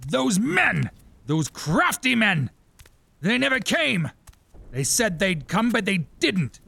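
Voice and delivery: rant, voice climbing; unhinged king ranting about the men; raving king. Acted. Herod raving.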